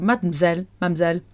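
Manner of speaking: "mademoiselle" clipped to "mam-zelle"; quick two-syllable "mademoiselle", middle swallowed; fast, contracted. Shown as a demonstration. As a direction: run "mademoiselle" together quickly as mad-m'zelle. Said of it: "When you hear it fast".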